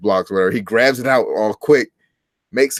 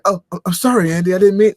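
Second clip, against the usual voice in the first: high, stammering mock apology; upset apology; 10 semitones higher, 4 dB louder, faster than usual. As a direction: imitate someone distraught at hurting a friend, rising in pitch. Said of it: "he was really upset that he hurt Andy".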